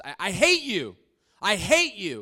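loud, high, bitter shout; angry shout. Retold or imitated. Woman shouting at the man who loved her, bitter. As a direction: throw the words at him, furious. Woman rejects him angrily.

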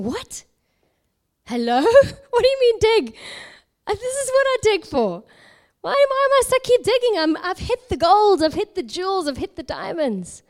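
loud, high-pitched incredulous questioning; bewildered protest. Retold or imitated. Digger objects to God's order to continue, bewildered. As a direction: protest in comic disbelief, pitch leaping up. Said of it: "He's bewildered".

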